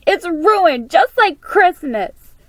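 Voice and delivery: high, loud and slow despairing cry. A narrator's reading. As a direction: wail it as she runs off. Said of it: "Clara cried out".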